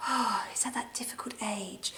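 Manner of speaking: put-on confiding whisper about the teenager; mum's whisper. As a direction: whisper it like a worried mum. Mum whispers about teen.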